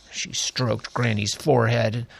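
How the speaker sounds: ordinary delivery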